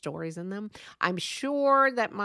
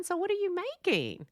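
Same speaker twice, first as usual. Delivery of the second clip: much higher-pitched, voiced parental question; parents' question; 12 semitones higher than usual. Parents ask their son about his carving, high-pitched questioning.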